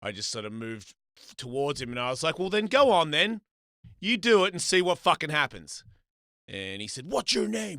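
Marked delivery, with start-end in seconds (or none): 2.4-5.8 loud, raised dare; heated, emphatic warning; retold threat. His retold threat to stranger. 7.1-7.8 high-pitched demanding question; retold cocky demand; imitated challenge.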